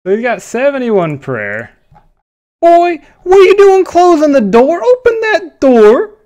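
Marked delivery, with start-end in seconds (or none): 2.6-6.1 high, loud scolding, dragged out on "Open that door"; indignant yell. Real, not acted. Yelling at a stranger who closed the door, loud indignant scolding.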